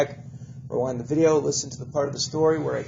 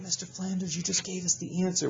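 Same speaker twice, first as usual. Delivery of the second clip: pupil voice; 4 semitones higher, 5 dB quieter than usual. Pretend. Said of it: "some of you might be going".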